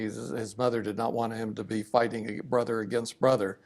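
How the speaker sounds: ordinary talk, nothing marked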